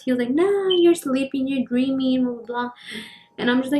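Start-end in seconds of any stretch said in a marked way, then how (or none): 0.3-2.7 dismissive dad. Retold or imitated. Disbelieving dad brushing off child's story, mock dismissive. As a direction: imitate dad waving it away. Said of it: "my dad obviously didn't believe us".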